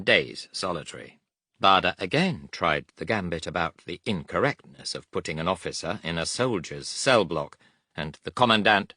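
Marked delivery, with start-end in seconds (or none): none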